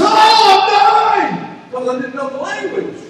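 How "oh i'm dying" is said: loud, high, drawn-out panicked wail; mock panic. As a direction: cry out in exaggerated dread of dying. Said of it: "I went from absolute".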